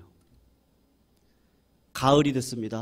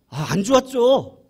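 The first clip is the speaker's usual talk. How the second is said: rueful admission; 9 semitones higher, 8 dB louder than usual. Retold or imitated. Farmer recalling his loss.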